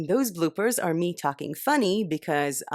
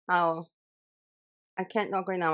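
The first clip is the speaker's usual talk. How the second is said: ordinary talk, nothing marked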